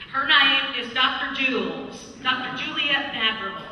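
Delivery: mimicking the Texas doctor's accent while introducing her. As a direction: lightly imitate a Texan doctor's accent. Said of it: "I'm gonna do her accent".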